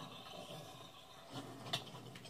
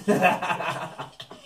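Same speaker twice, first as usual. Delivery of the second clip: short laugh; 4 semitones higher, 4 dB louder than usual. Real, not acted. Laughing at catchphrase.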